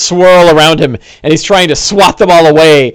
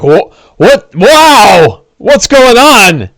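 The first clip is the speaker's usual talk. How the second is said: higher, louder bewildered cry, slowing down; confused; 7 semitones higher, 4 dB louder, much slower than usual. Acted. Don John baffled by the sudden fight, confused outcry. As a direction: stumble over the words in confusion. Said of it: "he seems confused".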